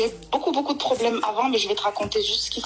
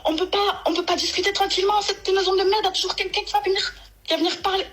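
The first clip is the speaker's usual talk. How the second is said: mock furious rant pitched much higher; angry outburst; 10 semitones higher than usual. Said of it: "sur un ton nerveux".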